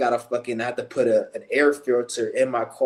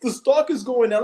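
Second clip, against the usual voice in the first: much higher, whiny mock voice; mocking dumb people; 12 semitones higher than usual. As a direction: parody them in a shrill-pitched sneer. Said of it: "dumbass people out here talking about".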